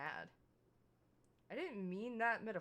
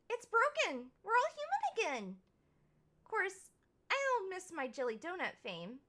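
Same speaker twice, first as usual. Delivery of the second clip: put-on high, bright, excited girlish voice; bubbly Finley; 8 semitones higher, 6 dB louder than usual. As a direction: gush with joy, pitched way up. Voices cheerful Finley character.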